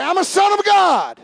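loud, high, leading the congregation; call-out. Shown as a demonstration. Pastor leads the congregation in a loud call-and-response. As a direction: shout it for them to repeat.

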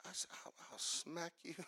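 very soft, under the breath; barely audible playful grumble; muttered threat. Acted. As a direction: mutter a mock threat to yourself. Acting out being ignored, muttered reaction.

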